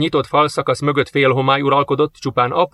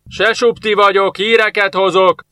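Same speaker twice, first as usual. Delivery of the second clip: higher, louder, slow call; shouting out; 8 semitones higher, 4 dB louder than usual. Acted. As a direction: call out into the empty desert. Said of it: "kiáltott a harcos a sivatagba".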